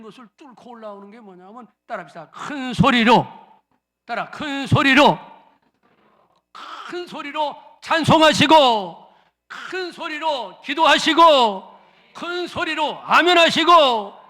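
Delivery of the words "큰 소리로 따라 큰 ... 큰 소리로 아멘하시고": loud, slow urging; rally the people to raise their voices; full-force preaching. Acted. Pastor urging the congregation loudly.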